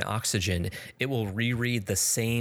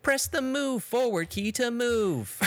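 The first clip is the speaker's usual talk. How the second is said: hammed-up imitation of a condescending game tutorial; mocking tutorial robot; 7 semitones higher than usual. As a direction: talk down to the player like a smug machine. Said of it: "read by an extremely unlikable and uninteresting robot".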